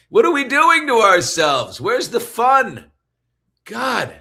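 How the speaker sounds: loud, high cry of exasperation; exasperated outburst. Pretend. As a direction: throw it out as a big loud lament.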